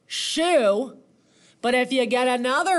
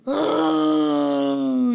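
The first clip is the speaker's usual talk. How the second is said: loud acted "ohhh" sigh; big sigh; 4 semitones higher, 5 dB louder than usual. A narrator's reading. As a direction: gasp in, then a loud drawn-out sigh. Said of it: "he sighed".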